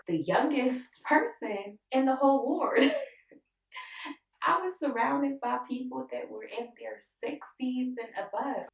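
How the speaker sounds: laughing. Real, not acted. Laughing at own situation.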